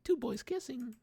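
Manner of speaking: squeaky high "ew gross" voice; mock disgusted kid. Young self imagined mocking boys kissing, high squeaky disgust. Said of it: "never something I'd be like".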